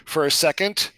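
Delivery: ordinary delivery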